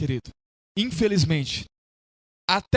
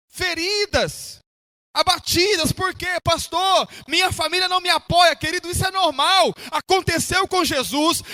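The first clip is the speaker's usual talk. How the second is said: raised, emphatic preacher delivery; fervent pulpit exhortation; impassioned preaching; 7 semitones higher than usual. Real, not acted. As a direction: preach it to the congregation with stretched key words. Preaches at full force.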